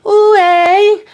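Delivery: high, loud sing-song greeting; high-pitched hammed-up hey; Color Purple impression. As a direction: mimic a Color Purple hello. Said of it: "He basically doing the beat from Damn Color Purple".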